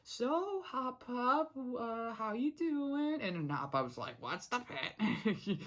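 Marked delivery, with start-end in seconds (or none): 0.0-3.2 high, drawn-out cartoon voice; Sprig. Imitating Sprig coming in to Hop Pop, high put-on voice. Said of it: "Like, Sprig comes in". 4.2-5.6 slow, put-on cartoon accent; Hop Pop. Imitating Hop Pop's answer to Sprig, slow put-on accent. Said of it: "doing the Hop Pop accent".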